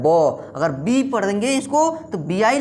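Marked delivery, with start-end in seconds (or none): 0.0-0.5 ब low, as b plus open 'aw'; sound of B. Shown as a demonstration. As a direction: demonstrate ब as a sound, not 'bee'. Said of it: "चूँकि वो ब होता है". (ब की ध्वनि नीचे सुर में बोलें)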